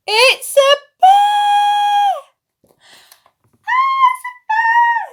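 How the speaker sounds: high, loud, slow scream; frightened shout. A narrator's reading. Children's storyteller, shrieking as the bear appears. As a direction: shriek at the sight of it.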